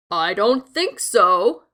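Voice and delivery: slow, firm big goat; calmly refuse, slow and firm; big goat replies. A narrator's reading. Biggest goat defying the troll, slow and firm. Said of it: "the billy goat replied".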